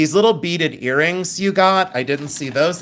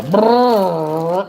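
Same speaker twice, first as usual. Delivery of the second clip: trombone brr; 4 semitones higher, 4 dB louder than usual. Retold or imitated. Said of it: "sound like the trombone on Fear Factor".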